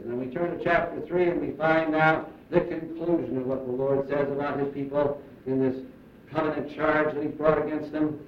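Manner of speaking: ordinary talk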